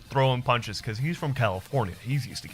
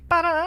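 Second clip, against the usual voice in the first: playful exclamation; 5 dB louder than usual. Real, not acted. Playful sound.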